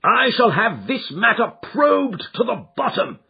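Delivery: high, loud vow in rage; raging threat. Acted. Father half mad with grief vowing to call the police. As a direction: swear it out in fury. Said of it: "half mad with grief and rage".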